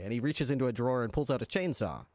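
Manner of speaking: ordinary delivery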